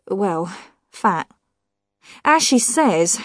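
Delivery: plain reading voice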